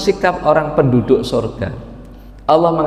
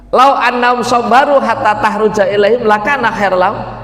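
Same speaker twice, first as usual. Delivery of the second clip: raised chanting recitation; Quran recitation; 6 semitones higher than usual. Shown as a demonstration. Preacher quoting verse to congregation, recited.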